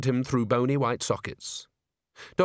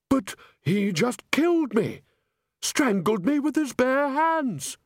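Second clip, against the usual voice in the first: high, slow, shocked voice; alarmed; 13 semitones higher, slower than usual. Acted. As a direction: recoil in alarm. Ghost indignant about murder.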